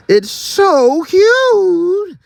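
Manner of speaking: very high, drawn-out squeal. Acted. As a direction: squeal it out with delight. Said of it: "the donkey squealed".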